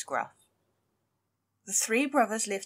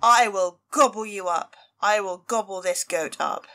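a loud, hungry troll roar; troll yelling; 7 dB louder than usual. Acted. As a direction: bellow it at the goat. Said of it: "and yelled".

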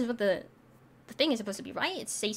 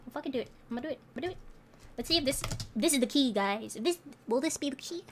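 usual speaking voice

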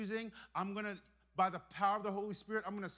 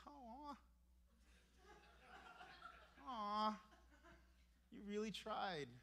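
bubbly pity; 11 dB quieter, slower than usual. Retold or imitated. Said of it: "I get so bubbly".

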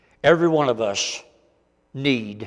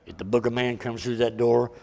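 much softer, lower, close and earnest; intimate sincere appeal; 5 semitones lower, 9 dB quieter than usual. Real, not acted. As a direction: lean in and speak straight to their hearts.

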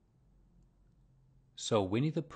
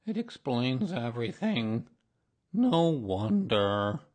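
drawn-out doleful donkey voice; slow it into a heavy lament; gloomy; 3 semitones higher, 6 dB louder, slower than usual. A narrator's reading. Donkey reflecting on his lost tail, gloomily. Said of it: "said Eeyore gloomily".

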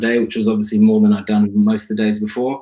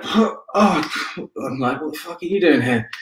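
shocked; 6 semitones higher than usual. Retold or imitated. Woken up, sees cricketer.

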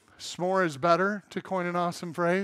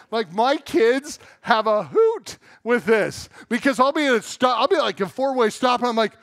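usual speaking voice

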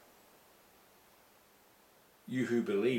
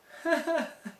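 a high burst of happy laughter; delighted laugh. Real, not acted. Preacher laughing with joy after his sermon.

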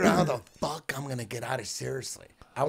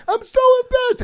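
very high, loud squeal; mock her embarrassed wail, way up high; shrieky mock-female whine; mimicking embarrassed woman; 5 dB louder, faster than usual. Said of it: "She goes".

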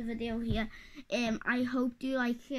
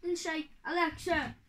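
loud, higher annoyed yell; annoyed shout; 5 semitones higher, 5 dB louder than usual. Real, not acted. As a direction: snap at the smart speaker. Yelling at Alexa.

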